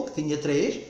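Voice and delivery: ordinary talk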